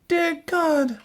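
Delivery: put-on very high, pleading girl's voice; little girl crying out. A narrator's reading. Little girl praying in despair, high cry. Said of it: "she cried".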